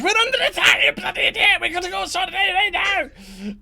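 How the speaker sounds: high, loud panicked yell; shouting alarm. Acted. Redcap yelling at his companion about the attack, shouted. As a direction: scream the alarm at him. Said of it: "as he shouts".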